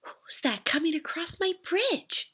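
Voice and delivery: high, bright-voiced little ogre calling out; Little Ogre greeting whoever crosses his bridge; cheerful little ogre. A narrator's reading. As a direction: sing it out like a friendly little ogre. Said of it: "the ogre asked cheerfully".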